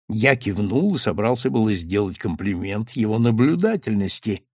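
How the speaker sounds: ordinary delivery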